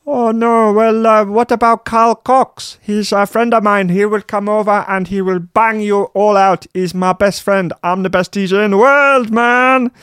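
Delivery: high squeaky whiny Dutch DJ. Acted. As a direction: play a pompous squeaky superstar. [name] voice again.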